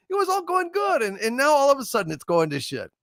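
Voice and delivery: very high, rushed complaint; a god whining that it all went wrong; mock exasperation. [name] grumbling to viewers that the night is ruined, high and rushed.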